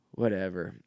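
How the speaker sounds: ordinary talk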